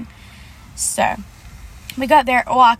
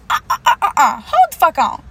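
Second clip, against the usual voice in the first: indignant cut-off; 4 semitones higher, 4 dB louder than usual. Retold or imitated. Cutting off the shop owner.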